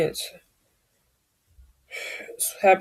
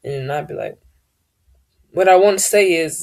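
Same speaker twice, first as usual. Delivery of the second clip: ordinary delivery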